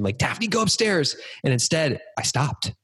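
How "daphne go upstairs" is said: almost-scream. Retold or imitated. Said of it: "I was about to scream".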